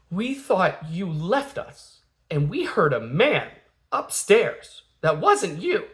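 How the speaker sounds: imitating a tearful child, swooping high; mock crying. Scared eldest daughter to dad, mock-tearful wail. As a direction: wail it like a distraught kid, drawn out. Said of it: "she's, like, crying".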